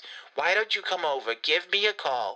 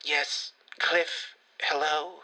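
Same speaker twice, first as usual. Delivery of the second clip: usual speaking voice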